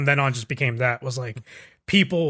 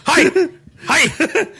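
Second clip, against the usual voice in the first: high, loud shout of "Hi!"; sharp martial-arts yell, played for laughs; mock training yell; 10 semitones higher, 5 dB louder, much slower than usual. Podcaster imitating trainees at eye exercises, mock yelling. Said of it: "they're just yelling every time".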